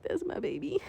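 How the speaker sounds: breathy tearful whisper. Real, not acted. Vlogger about her goddaughter, whispered through tears. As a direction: whisper it, still choked up.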